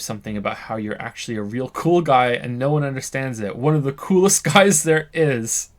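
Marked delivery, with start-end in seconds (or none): 3.6-5.7 laughing. Acted. Mocking the boastful claim.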